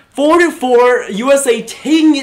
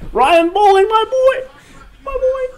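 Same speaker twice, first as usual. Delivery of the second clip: much higher, squealing with joy; giddy high cheer; 13 semitones higher than usual. Real, not acted. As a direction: squeal it out for your favorite player. Praising the goal scorer.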